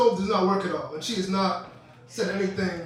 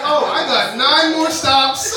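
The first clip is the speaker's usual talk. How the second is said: mock panic; 4 semitones higher, 7 dB louder, slower than usual. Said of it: "So now I'm like".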